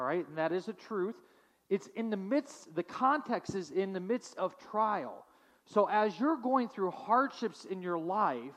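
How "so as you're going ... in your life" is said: ordinary talk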